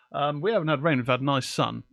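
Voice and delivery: ordinary talk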